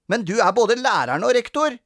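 put-on high, loud childish protest; indignant complaint. A narrator's reading. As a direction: object like a child cheated in a game. Sara protests in quarrel.

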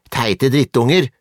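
slow, high-pitched jeer; acted boy's angry yell, not full force; shouted insult. A narrator's reading. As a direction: spit the insult at the kids. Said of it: "ropte han og sparket".

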